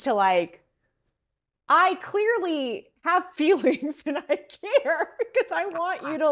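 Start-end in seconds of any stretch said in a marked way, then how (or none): 1.7-6.3 high-pitched mock gush of feelings with a laugh; playfully overwrought, half laughing; mock emotional. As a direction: imitate someone suddenly overflowing with feeling, much higher. Acted quote, sudden gush of feelings.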